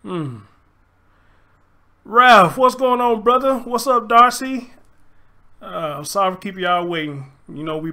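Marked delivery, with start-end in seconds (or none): 2.0-4.7 loud, high greeting shout-out; calling out. Real, not acted. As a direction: call the names out to the chat. Greets chat members by name.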